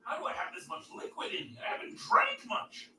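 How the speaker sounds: faint, off-mic speech; distant voice. Real, not acted. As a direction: talk from the next room. Far from mic in bathroom.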